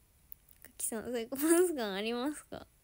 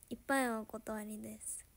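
ordinary delivery

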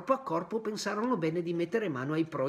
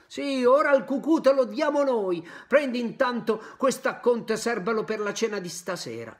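loud, raised mocking threat; angry schoolboys; 7 semitones higher, 7 dB louder than usual. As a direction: hand him his punishment with a sneer. Boys attack Pinocchio.

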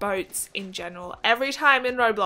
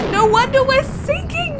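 much higher and louder exclamation; incredulous outburst; 12 semitones higher, 8 dB louder than usual. Real, not acted. Gamer discovering nobody steers the ship, incredulous outburst. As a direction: burst out in disbelief. Finds captain not steering.

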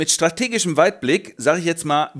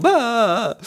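scared orc yelp; 9 semitones higher than usual. Acted. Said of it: "und die Orks so".

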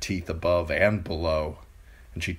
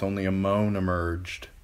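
usual speaking voice

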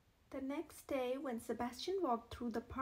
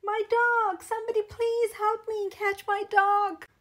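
much higher and louder, yelling for help; desperate shout; 8 semitones higher, 13 dB louder than usual. A narrator's reading. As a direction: scream across the park for your runaway dog. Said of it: "he didn't hear the shouting".

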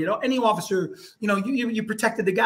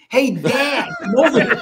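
raised high call across the room; 5 semitones higher, 5 dB louder than usual. Retold or imitated. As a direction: call dad over loudly. Daughter calling her father over.

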